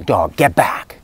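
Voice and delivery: calm yet assertive, tell the dog to back off; a firm "Dog, get back" that is not yelled. Shown as a demonstration. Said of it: "using maybe your firm, loud voice at first".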